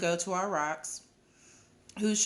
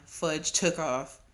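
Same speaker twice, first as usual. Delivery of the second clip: usual speaking voice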